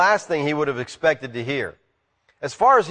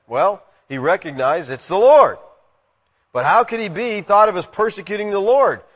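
usual speaking voice

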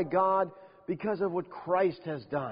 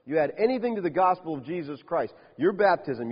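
ordinary delivery

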